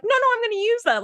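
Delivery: high, fast mock mom protest; mom insisting. Mom refusing to throw out the singed hair dryer, high and quick. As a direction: squeak it out like an eager mom. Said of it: "my mom is like".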